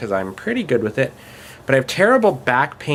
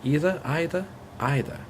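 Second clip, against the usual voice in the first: a posher, higher British accent, "Either" said "ee-ther" then "eye-ther"; other British accent; 6 semitones higher, 5 dB quieter than usual. Shown as a demonstration. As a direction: lift the pitch for a second kind of British on "Either". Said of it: "the other kind of British".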